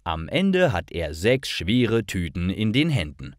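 normal talking voice

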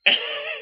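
high-pitched mimicked giggle, loud; imitated laughing. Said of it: "And she starts laughing".

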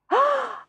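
sharp high gasp of shock; despairing gasp. A narrator's reading. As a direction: gasp at the empty sack. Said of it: "He was in despair".

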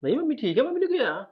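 high-pitched, taken-aback reassurance; surprised denial. Acted. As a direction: brush off the worry with a high, puzzled question. Husband brushes off wife's worry.

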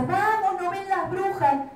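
high, loud urgent cry; alarmed shout. A narrator's reading. The grown-ups urge the children to see the witches. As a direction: yell at them to look up.

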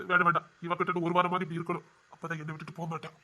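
hushed note to self, fading away; private aside. Acted. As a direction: drop to a murmur, talking to yourself. Private aside, hiding his feelings.

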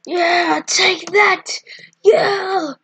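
high, loud victory whoop; triumphant cheer. Real, not acted. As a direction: cheer as you land the hit. Cheering a hit in game.

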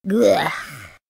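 hammed-up retching gag; gagging noise. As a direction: gag as if about to be sick. Almost sick after coffee.

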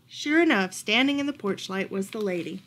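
ordinary delivery

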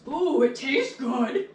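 slow, drawn-out cartoon character voice. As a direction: say it like a goofy cartoon. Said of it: "I just sound like a cartoon character when I say that".

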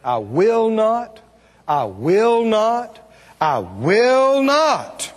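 "I will not" very slow, each word stretched; emphatic triple repetition. Shown as a demonstration. Preacher repeating God's promise, "I will not" slow and emphatic. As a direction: draw out "I will not", weightier on the last repeat. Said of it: "it's emphasized like that".

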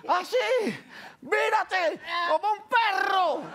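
high, hammed-up commands; imitated trainer's shouting. Trainer orders him about like a dog, mock shouting. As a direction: shout it in stretched bursts. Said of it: "hay una parte que él le dice".

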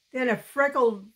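usual speaking voice

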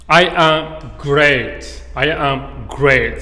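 loud, very slow and drawn out; emphatic slow model. Shown as a demonstration. Teacher presents phrase four to learners, slow and emphatic. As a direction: stretch GREAT out proudly and loudly.

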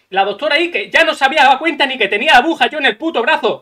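ordinary talk, nothing marked

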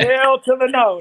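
hell to the no, slow and punchy; emphatic no. Real, not acted. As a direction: stretch it out, loud and final. Emphatic refusal to interviewer.

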